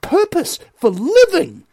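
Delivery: high, slow, emphatic proclamation; impassioned oratory. Real, not acted. Commentator telling listeners their purpose, impassioned. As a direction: declaim it high with weighty breaks between phrases.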